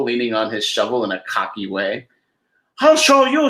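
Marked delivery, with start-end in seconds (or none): 2.8-3.5 higher, louder threat; defiant leprechaun. Acted. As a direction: snap back like a proud leprechaun. Patrick threatens Donald.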